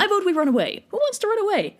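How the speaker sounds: high, eager cowardly voice; high, eager mock coward; cowardly whine. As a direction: pitch it up, eager to flee. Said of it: "So Agamemnon's like".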